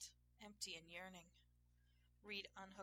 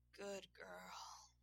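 breathy, voiceless murmur; panted. A narrator's reading. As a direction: let it out on spent breath. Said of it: "he panted".

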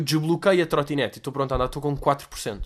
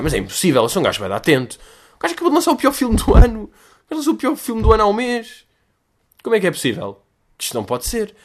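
incredulous inner thought; 7 semitones higher, 6 dB louder than usual. Retold or imitated. Shocked inner thought retold.

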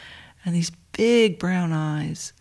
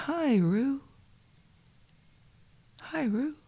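much higher, hushed coo; cooing to a puppy; 8 semitones higher, 5 dB quieter than usual. Retold or imitated. Speaker greeting the little puppy Rue, high hushed coo. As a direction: greet the dog tenderly, softly and up high.

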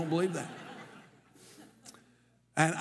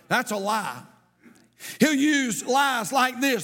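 higher, louder sermon delivery with a sing-song lilt; full-force preaching; 7 semitones higher, 3 dB louder than usual. Acted. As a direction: preach it out to the congregation.

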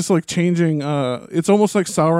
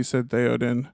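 usual speaking voice

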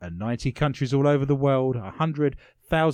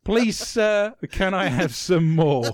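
Oliver Twist plea; 7 semitones higher, 6 dB louder, slower than usual. Pretend. Oliver Twist quote acted.

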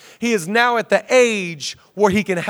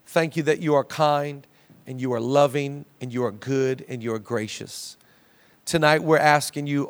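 hushed, lowered prayer voice; quiet devoted church prayer; 5 semitones lower, 3 dB quieter than usual. Acted. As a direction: pray it softly and intimately to God. Opening prayer before sermon.

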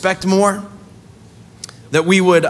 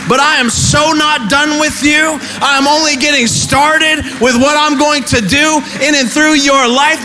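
higher, louder preaching build with shouts; rally the church, voice lifted high; preaching at full force; 7 semitones higher, 4 dB louder than usual. Acted. Preacher firing up the congregation, full-voiced sermon climax.

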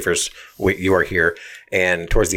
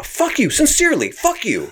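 high, exaggerated fake-polite sign-off; mock sarcastic insult. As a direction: sneer it in a put-on high voice. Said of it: "he goes".

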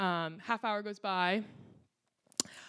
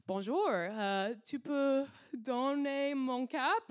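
put-on slow, higher, hesitant French with an English accent; awkward schoolgirl French; 5 semitones higher, slower than usual. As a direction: stumble through shy French, slowly. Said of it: "was too embarrassed to be like".

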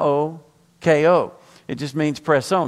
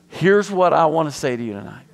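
normal talking voice

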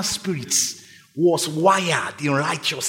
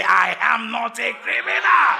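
shout it out; 5 semitones higher than usual. Shown as a demonstration. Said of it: "I need somebody to shout it".

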